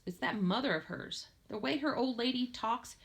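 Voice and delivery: usual speaking voice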